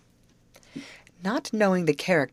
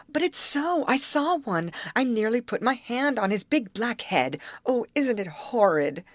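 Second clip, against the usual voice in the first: high, anxious and shuddering; frightened insistence; 7 semitones higher than usual. A narrator's reading. Grace telling the others she saw an alligator, scared. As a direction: pitch it up as if still shaken.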